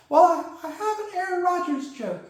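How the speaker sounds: high puppet voice for Corky; Corky's voice. Acted. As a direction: pitch it up into the sidekick puppet.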